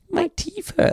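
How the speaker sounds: put-on high, slow whiny child; little girl voice. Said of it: "the poor little girl".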